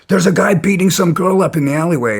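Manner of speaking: retold alarm, pitched well up; alarmed report. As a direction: blurt it out like urgent news. Stranger rushing out with alarm.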